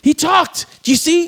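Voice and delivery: high, excited mock proclamation; acted astonished excitement; amazed announcement. Acting out excited announcement to others.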